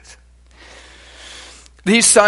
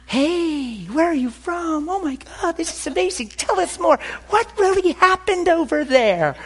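put-on much higher, gushing excited girls; eager girls. Girls rushing over to ask the guest about his story, gushing and high. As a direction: gush like starstruck young women.

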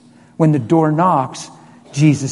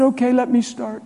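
emotional reply; 7 semitones higher than usual. Retold or imitated. Son bawling, father answers emotionally.

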